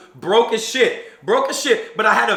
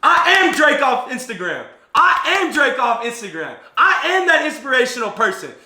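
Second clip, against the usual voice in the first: swaggering self-belief; 5 semitones higher, 4 dB louder than usual. Retold or imitated. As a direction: puff up and announce yourself. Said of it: "I started walking different".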